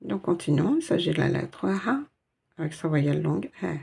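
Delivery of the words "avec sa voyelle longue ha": normal talking voice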